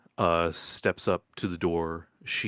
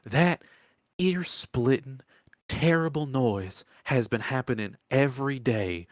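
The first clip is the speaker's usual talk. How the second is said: put-on old swamp woman, exasperated; 4 semitones higher than usual. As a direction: lament it with emphatic weariness.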